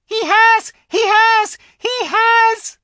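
very high, loud squeaks; a small creature bursting out; squeaking squirrel. A narrator's reading. Young squirrel squeaking at the witch. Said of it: "it squeaked".

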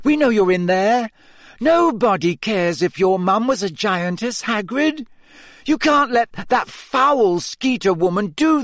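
high, loud pleading yells; shouting. A narrator's reading. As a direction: pound the door and shout. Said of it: "Hermione shouted".

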